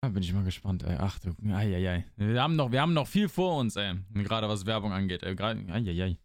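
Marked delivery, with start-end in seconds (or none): none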